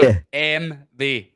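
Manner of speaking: B M W slow and loud as "bay-em-vay"; German letter names. Shown as a demonstration. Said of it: "No, B".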